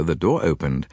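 ordinary delivery

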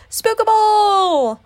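high, loud, drawn-out howl; panicked wail. A narrator's reading. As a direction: wail the word long and high. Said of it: "wailed Pooh".

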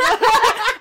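a loud squealing laugh; loud high laugh. Real, not acted. Big laugh.

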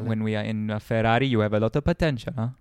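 put-on light Italian team boss accent; quick Italian-accented jab. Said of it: "in an Italian accent as well".

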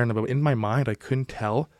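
ordinary talk, nothing marked

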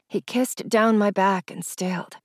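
ordinary delivery

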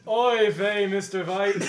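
Oy vey, Mister Vhite, Yiddish V; yiddish accent. Acted. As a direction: kvetch it like a Yiddish grandma.

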